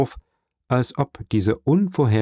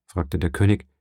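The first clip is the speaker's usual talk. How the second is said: usual speaking voice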